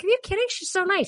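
high-pitched imitated protest; retold naive disbelief. Acts out own naive disbelief.

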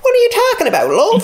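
high squawking comic character. Pretend. Host mimicking an invented TV character, high comic screech. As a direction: screech it playfully, far above your own voice.

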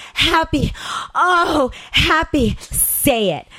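rising high, dragged-out climactic cry. Retold or imitated. Woman crying out happy new year at her lover's demand. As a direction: let it break out high and long. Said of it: "I cried".

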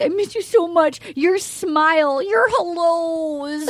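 slow, drawn-out tearful gushing; mock weeping. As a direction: stretch the words like a weepy mom. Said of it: "so then she's weeping".

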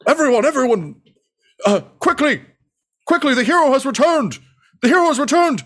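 high, loud proclaiming mayor; mayor yelling. Acted. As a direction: announce the hero's return like a pompous mayor. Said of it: "he just yells".

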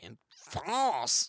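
drawn-out French pronunciation; French accent. Acted. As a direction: say it as a French mime. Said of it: "my French accent isn't that good".

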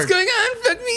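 very high falsetto, breaking into laughter; high girly voice cracking up; girl voice. Pretend. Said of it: "Let me try again".

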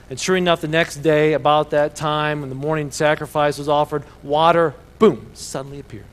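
loud, punchy Bible storytelling; dramatic scripture reading. A narrator's reading. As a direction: build to a booming reveal. Reads the Bible story aloud.

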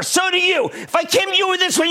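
normal talking voice

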